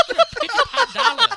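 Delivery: put-on high, slow, laughing old Korean man; old Korean man accent. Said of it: "let me try doing an old Korean guy".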